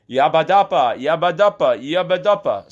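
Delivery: high, loud repeated cries; yelling in fake Polish. Shouting at a Polish owner for help, loud. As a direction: belt out each yabadapa as a plea. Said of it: "So I, I yelled that".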